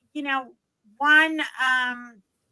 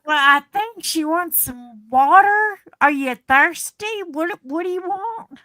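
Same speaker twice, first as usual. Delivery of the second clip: higher, mock Texan guessing; baffled Texan helper; 5 semitones higher, 6 dB louder than usual. Texan tries to work out what she wants, baffled. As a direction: imitate a local puzzling out a foreigner's request. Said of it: "moved to Texas 'cause no one could understand me".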